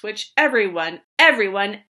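loud insistent repeat; yelling mad. Acted. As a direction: hammer it out. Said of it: "I yelled at the Lorax".

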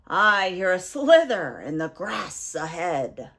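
low, loud monster voice; Gruffalo. Acted. Gruffalo hears something in the grass, low and loud. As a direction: speak low and big, like a lumbering beast.

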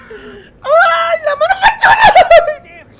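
high, slow, loud mock wail; hammed-up panic. Streamer acting out his fear-numbed hand, hammed-up wail. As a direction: stretch out a squealing comic cry.

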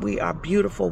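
normal talking voice

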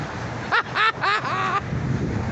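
big high cackle; high laugh. Real, not acted. Man laughing after cops brush him off, high cackle. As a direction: burst out laughing.

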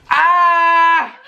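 high scream of spicy pain. Real, not acted. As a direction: yell out from the burning heat. Mouth burning after spicy wings.

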